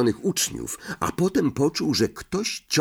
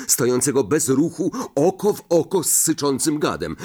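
ordinary delivery

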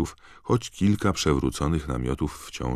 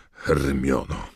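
pompous; 3 semitones higher, 5 dB louder than usual. A narrator's reading. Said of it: "oświadczył Percy pompatycznie".

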